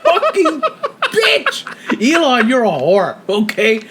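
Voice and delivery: high, laughing insult, dragged out slowly; laughing while cursing out Siri; laughing curse. Real, not acted. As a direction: cuss out the phone while cracking up.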